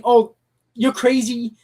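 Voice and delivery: ordinary talk, nothing marked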